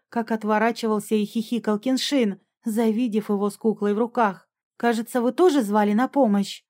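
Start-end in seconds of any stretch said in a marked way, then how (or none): none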